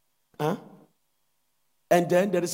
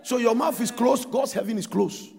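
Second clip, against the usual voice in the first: fervent loud preaching; 5 semitones higher, 12 dB louder than usual. Acted. Pastor urging congregation to speak loudly, fervent preaching. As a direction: lift the church with a loud charge.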